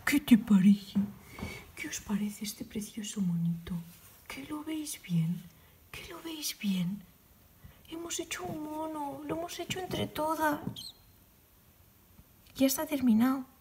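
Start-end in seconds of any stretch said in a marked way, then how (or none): 0.1-10.1 soft whisper, choked with emotion; much softer emotional whisper; moved whisper. Real, not acted. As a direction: whisper it, too moved to speak up. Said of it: "ya lo sabéis que estoy muy emocionada".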